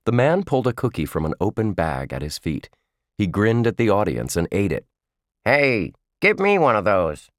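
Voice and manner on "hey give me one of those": dummy yelling. A narrator's reading. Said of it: "his dummy yelled".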